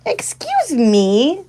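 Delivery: high, loud and very drawn out, mock offended; indignant excuse me. Real, not acted. Scolding the pushy pig in drawn-out mock outrage. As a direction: stretch it out, playfully affronted. Pig pushing onto her.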